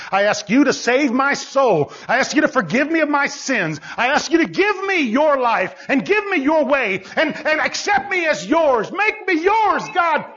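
impassioned preacher praying as the sinner; fervent prayer, building. Acted. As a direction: build each request toward an urgent appeal. Prayer builds to urgent appeal.

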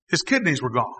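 ordinary talk

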